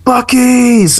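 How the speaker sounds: high, loud stranger calling out across the street; a stranger shouting across a parking lot at a guitar case sticker, high and loud; mock yell. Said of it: "Somebody yells at me".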